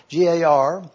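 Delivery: ordinary talk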